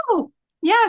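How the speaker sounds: imitated bright-eyed "oh, by the way"; sudden recollection. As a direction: pipe up as if just remembering. Aunt suddenly remembers, retold.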